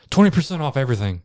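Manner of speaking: quick, fast-talking discount line; rushed sales tag. Retold or imitated. Host mimics the store owner tacking on a sale, fast. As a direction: rattle it off like a disclaimer.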